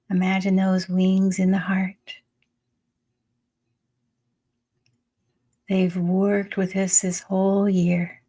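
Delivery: unhurried meditation voice with long pauses; calm, spacious meditation guiding; guided meditation. Acted. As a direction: lead them into the breath, phrase by phrase. Leads a breathing meditation.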